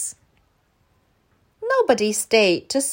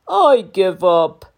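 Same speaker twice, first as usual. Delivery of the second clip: slow, louder give-up; defeated dinosaur; 8 dB louder, slower than usual. A narrator's reading. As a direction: sigh it out like a beaten giant. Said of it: "he said".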